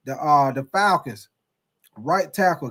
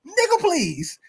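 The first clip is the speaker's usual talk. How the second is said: high-pitched mimicry of the dude; put-on high voice, mock dismissive; 11 semitones higher, slower than usual. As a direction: imitate him in a much higher voice.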